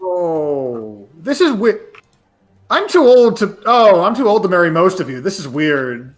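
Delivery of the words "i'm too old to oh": normal talking voice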